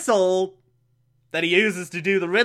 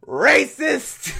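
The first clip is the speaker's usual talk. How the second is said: mock outrage; 9 semitones higher than usual. Real, not acted. Outraged joke at movie.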